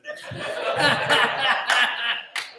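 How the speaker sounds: laughing. Real, not acted. Laughs after own joke.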